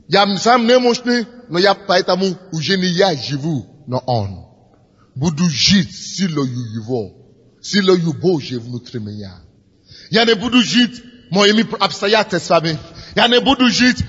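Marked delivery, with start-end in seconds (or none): none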